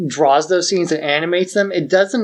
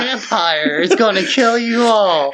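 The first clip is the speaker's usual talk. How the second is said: mock threat; 6 semitones higher than usual. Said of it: "It's like".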